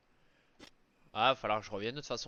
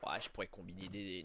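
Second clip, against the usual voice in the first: ordinary delivery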